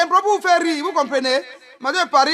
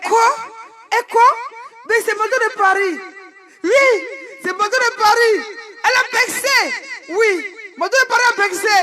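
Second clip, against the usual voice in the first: high-pitched excited exclaiming; mock outcry; 7 semitones higher than usual. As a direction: proclaim it in high, playful indignation. Mock outcry about Mado. (s'exclamer d'une voix aiguë)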